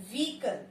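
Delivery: "Vehicle" as VEE-kul; correct pronunciation. Shown as a demonstration. Teacher naming the word, correctly pronounced. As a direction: say "Vehicle" with silent H. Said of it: "correct pronunciation of this word is".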